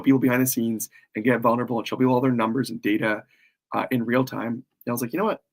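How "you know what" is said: ordinary talk, nothing marked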